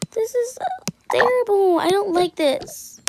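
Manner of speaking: high, loud whine; whiny complaint. Acted. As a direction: whine about the dress. Complaining about wearing a dress.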